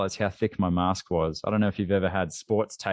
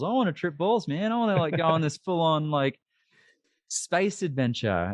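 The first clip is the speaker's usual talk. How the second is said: high, whiny wanting; whiny eager; 8 semitones higher than usual. Retold or imitated. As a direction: plead like a frustrated kid.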